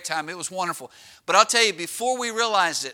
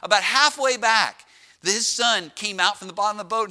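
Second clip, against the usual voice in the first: ordinary talk, nothing marked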